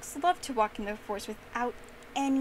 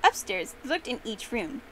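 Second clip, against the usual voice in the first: plain reading voice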